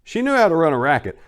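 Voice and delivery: plain reading voice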